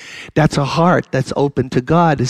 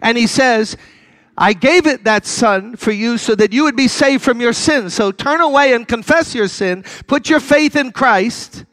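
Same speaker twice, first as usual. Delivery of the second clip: higher, pleading preacher's voice; impassioned preaching; 7 semitones higher than usual. Acted. Preacher urging listeners to give their lives to Christ, impassioned. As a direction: plead with them to turn.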